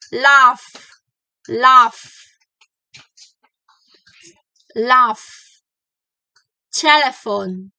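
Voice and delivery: each word loud, F hissed between teeth and lip; clear F words. Shown as a demonstration. Teacher drills the F words for the class, loud. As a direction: project every F. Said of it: "Here we go".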